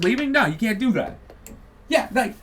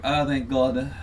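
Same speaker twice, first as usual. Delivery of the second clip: lightly voiced wife thanking heaven; mock Melania sighing with relief; mock relieved wife; 7 semitones lower than usual. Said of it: "Melania's looking over at him like".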